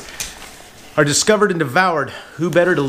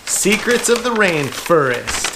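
ordinary delivery

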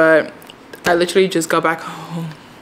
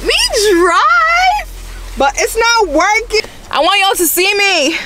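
excited whine; 3 dB louder than usual. Real, not acted. Camera trouble, excited pleading.

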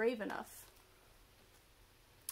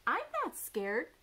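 higher, mildly indignant little owl; lightly voiced baby owl; Baby Owl protesting; 6 semitones higher, 4 dB louder than usual. A narrator's reading. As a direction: protest like a small owlet. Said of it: "said Baby Owl".

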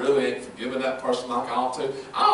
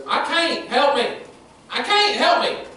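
Gentry's plea; 12 semitones higher, 4 dB louder, slower than usual. Retold or imitated. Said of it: "what Gentry loves to say".

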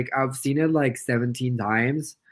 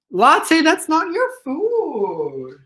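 high-pitched, drawn-out telling-off; scolding a pet; 12 semitones higher, much slower than usual. Real, not acted. Streamer catching pet at the food, scolding. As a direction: call out high to a naughty pet.

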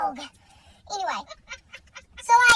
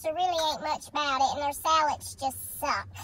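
normal talking voice

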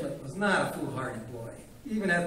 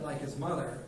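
plain reading voice

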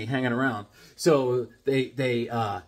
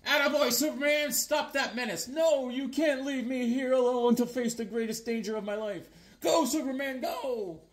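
comic damsel voice; 9 semitones higher, 3 dB quieter than usual. Pretend. Reads comic dialogue aloud.

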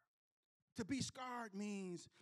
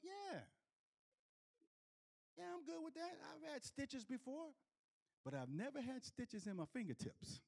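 nervous agreeing; 6 semitones higher, 6 dB quieter than usual. Retold or imitated. Retold himself nervously agreeing.

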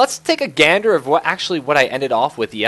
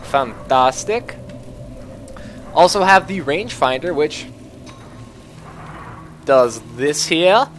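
normal talking voice

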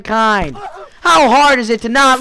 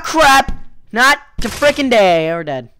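ordinary talk

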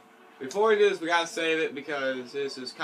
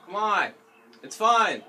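louder, higher coaxing; insistent pleading; 4 semitones higher, 6 dB louder than usual. Real, not acted. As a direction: plead to get the game going. Begging friend to play the game.

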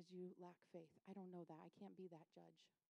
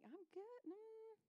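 high, soft squeaky decline; reluctant refusal; 11 semitones higher, 6 dB quieter, much slower than usual. Acted. Declining a friend who insists on going, reluctant. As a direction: wriggle out of it in a small high voice.

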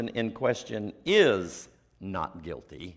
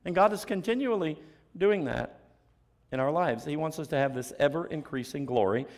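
usual speaking voice

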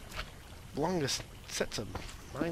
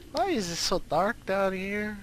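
whiny plea for lights, raised; odd put-on voice; 5 semitones higher, 4 dB louder than usual. Gamer alone in dark mine, whiny put-on plea. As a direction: complain like a lost kid in the dark. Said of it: "Why am I talking like this?".

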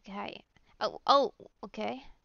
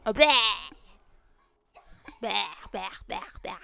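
disgusted by taste; 3 semitones lower, 7 dB louder, faster than usual. Real, not acted. Reacting with disgust.

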